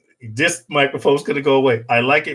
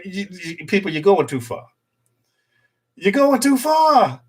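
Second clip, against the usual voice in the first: mock scolding; 4 semitones higher than usual. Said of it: "I was like".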